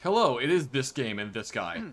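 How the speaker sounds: usual speaking voice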